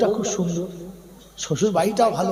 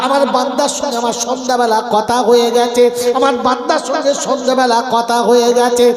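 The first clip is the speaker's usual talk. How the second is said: loud, driving sermon voice at full force; fiery preaching; 4 semitones higher, 10 dB louder than usual. Acted. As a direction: preach it out to the crowd, high and loud. Preacher delivering quoted scripture story.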